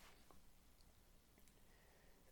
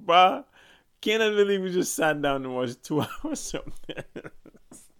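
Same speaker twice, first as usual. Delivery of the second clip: louder, laughing through the complaint; laughing disbelief; 7 semitones higher, 9 dB louder than usual. Retold or imitated. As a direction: laugh at wasting two hours. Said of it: "we were like".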